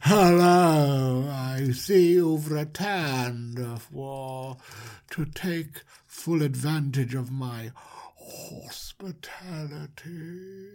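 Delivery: slow, drawn-out creepy welcome; creepy host. Acted. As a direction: stretch out the words like an eerie host. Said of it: "So creepy".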